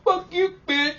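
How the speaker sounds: very high, loud mock scream; hammed-up high-pitched tantrum; enraged kid. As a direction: shriek it as the furious kid. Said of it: "he's all".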